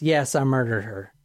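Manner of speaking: low, deadpan confession; emotionless. Retold or imitated. Defendant confessing to her lawyer, emotionless. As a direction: confess with no feeling, voice dropped low. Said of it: "And she's emotionless".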